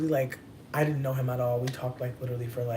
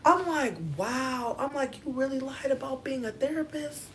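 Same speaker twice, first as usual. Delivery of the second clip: high-pitched disbelief; 12 semitones higher, 3 dB louder, slower than usual. Retold or imitated. Shocked reaction, high pitched.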